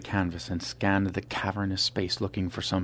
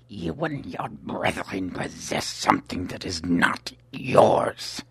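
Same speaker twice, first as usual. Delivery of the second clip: a cold assassin's whispered threat; menacing whisper; slower than usual. Acted.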